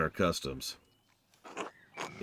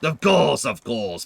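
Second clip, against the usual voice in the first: German accent; 3 semitones higher, 4 dB louder, slower than usual. Shown as a demonstration. Streamer voicing a character in a German accent. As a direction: clip "Of course" in a put-on German accent. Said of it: "I gotta do German".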